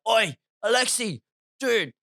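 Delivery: high-pitched retold angry rant; worked-up anger. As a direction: call a mate over, acting all fired up. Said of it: "I'm so angry".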